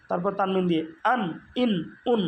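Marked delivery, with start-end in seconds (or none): 1.1-2.2 short, separate "আন, ইন, উন"; abrupt nunation drill. Shown as a demonstration. Teacher adds a final n to hamza, abrupt. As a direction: say "আন, ইন, উন" with sudden onsets. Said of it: "হঠাৎ করে উচ্চারণ করতে হবে".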